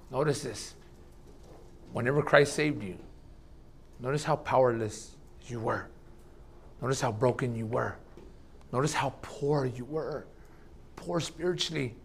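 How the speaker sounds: hushed preacher reminding the church of their brokenness; quiet reflection. Acted. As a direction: draw them close with a hushed appeal. Intimate reflective appeal.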